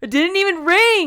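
high, loud and slow; shocked outburst. Real, not acted. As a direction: burst out in disbelief. Shocked after creepy call.

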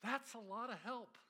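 sarcastic exasperation. Retold or imitated. Pastor mocking his own reaction to the verse, sarcastic. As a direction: throw up your hands at the unhelpful verse.

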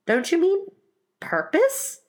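very high, halting question; questioning Alice. Acted. Alice corrects the Mock Turtle, high and questioning.

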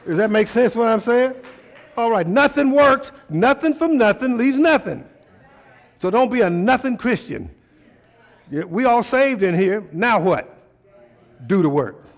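loud, high preaching call; rising fervent preacher. Acted. Preacher raising voice.

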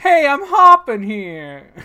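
loud, high, slow Brooklyn tough; Brooklyn accent. Retold or imitated. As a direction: belt it like a hammy Brooklyn thug. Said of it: "like, Brooklyn accent".

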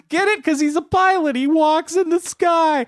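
very high, laughing through the name; giddy high-pitched laughing reveal; cackling announcement. Acted. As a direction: crack up at your own joke. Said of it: "He says".

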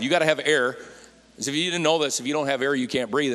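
normal talking voice

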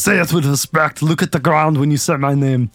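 lightly voiced action-star impression; a hint of a stern tough guy demanding respect; mock Arnold Schwarzenegger. Joking host imitates the actor demanding respect, lightly voiced. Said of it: "Can you do any voices?".

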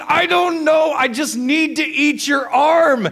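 high, loud, desperate shout; acted screaming. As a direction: holler like a delirious half-asleep husband. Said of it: "You are screaming".

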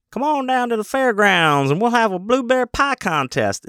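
mock small-town carnival barker; folksy fair announcer. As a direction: sell the blueberry pie contest like a hokey announcer.

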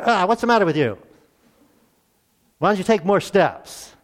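put-on loud, high scolding of a toddler; a mock-impatient parent scolding. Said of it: "You're not angry with them".

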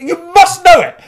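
very high, loud mock shriek; desperate shrieked insistence. Actress at breaking point with audience member, desperate shriek. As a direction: shriek it as a performer losing control. Same actor's insistence escalates.

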